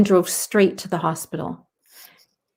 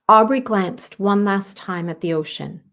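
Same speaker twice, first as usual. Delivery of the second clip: usual speaking voice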